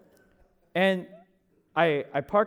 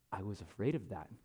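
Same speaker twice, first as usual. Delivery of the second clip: hushed, low, imitating the drunk passenger; acted-out murmur of a confession; quiet retold confession; 8 semitones lower, 13 dB quieter than usual. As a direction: drop right down for a small, low admission.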